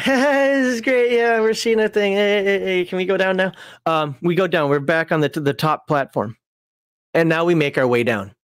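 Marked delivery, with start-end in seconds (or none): 0.0-3.5 high, strained mock cheer sliding down in pitch; nervous fake enthusiasm. Frightened climber at the top wanting down, nervous fake enthusiasm. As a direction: act thrilled while desperate to leave. Acted nervous reaction atop the tower.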